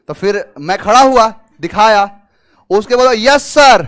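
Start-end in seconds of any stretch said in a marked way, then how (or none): 3.1-3.8 loud roll-call reply, imitated; acted loud answer. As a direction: answer up loudly to an officer. Acts candidate answering the examiner. (ज़ोर से हाज़िरी देने की नकल)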